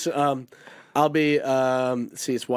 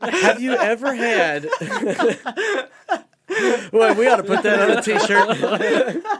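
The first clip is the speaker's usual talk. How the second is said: high, loud laughing through words; can't stop laughing; 10 semitones higher, 5 dB louder than usual. Real, not acted. Cracking up over the joke.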